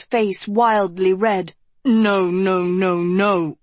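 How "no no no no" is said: flustered stammer, a touch rising. A narrator's reading. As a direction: stumble over the nos in alarm. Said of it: "he stammered".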